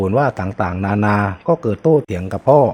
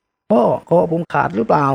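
dazed fear; 7 semitones higher, slower than usual. Acted. Wounded son asks father.

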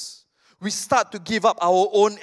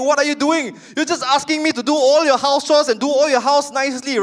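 angry Daniel-san; 7 semitones higher than usual. Retold or imitated. Daniel-san confronts Mister Miyagi, higher indignant complaint. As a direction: complain up high like an angry teen.

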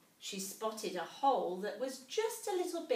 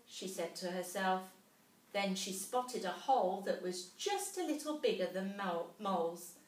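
ordinary delivery

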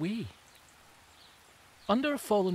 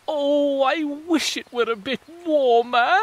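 mock sobbing rotifer; 10 semitones higher, 8 dB louder than usual. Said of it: "sobs a rotifer".

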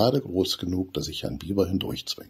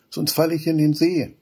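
higher, louder fearful plea; worried complaint; 10 semitones higher, 5 dB louder than usual. A narrator's reading. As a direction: afraid of falling in. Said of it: "beschwert sich Benny". (ängstlich, lauter klagend)

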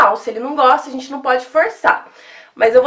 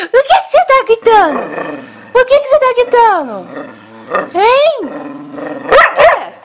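high pet talk; 11 semitones higher, 5 dB louder than usual. Real, not acted. Asking dog why it yells.

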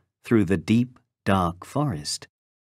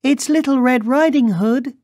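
put-on very high, loud little-girl voice; 5 dB louder, faster than usual. A narrator's reading. Girl announces herself at the door, high childlike call. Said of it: "she called".

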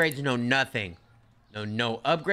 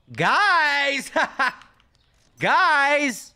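loud high call across the store; shouting guys; 11 semitones higher, 6 dB louder than usual. Real, not acted. Streamer calling out to the game workers, loud high shout. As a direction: yell for the workers to come over.

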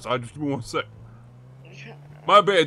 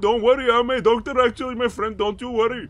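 put-on higher, louder, sing-song foreign accent; put-on foreign doctor; 6 semitones higher, 4 dB louder than usual. Fake doctor reassuring a patient, in a high put-on accent. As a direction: play a cheerful fake doctor with a thick accent.

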